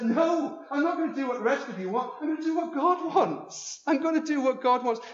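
high, loud refusal; determined Zechariah. Acted. Zechariah defying the crowd, high and loud. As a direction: plant your feet and declare it.

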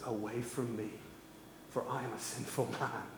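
hushed like a man on his knees; humbled hush. Acted. Peter kneeling before Jesus, hushed and awed.

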